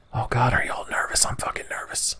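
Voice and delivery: unvoiced hush so the enemies won't hear; whisper. Real, not acted. Creeping up on sleeping enemies, a tense whisper. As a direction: breathe the words, nervous and hidden.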